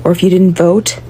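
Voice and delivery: usual speaking voice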